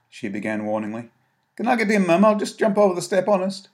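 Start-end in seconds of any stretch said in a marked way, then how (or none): 1.6-3.7 put-on much higher, louder child; a nervous lad from the streets; boy's voice. Tommy answering Mary, high and nervous. Boy asks his mum.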